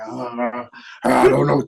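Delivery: a light, low Nolte mumble; Nick Nolte impression. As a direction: shrug it off like Nick Nolte, barely changed. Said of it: "That's how Nolte talks".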